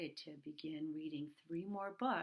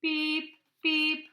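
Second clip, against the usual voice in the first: high, loud robot beeps; 9 semitones higher, 11 dB louder than usual. Acted. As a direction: beep like a little robot. Robots beeping again.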